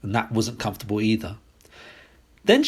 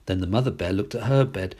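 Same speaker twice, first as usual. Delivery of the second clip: ordinary delivery, nothing marked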